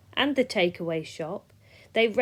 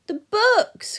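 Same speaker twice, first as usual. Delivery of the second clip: high, loud anguished cry; cried out; 14 semitones higher, 9 dB louder than usual. Acted. Peter crying out to the class about the missing books. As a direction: wail it in dismay. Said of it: "cried out Peter".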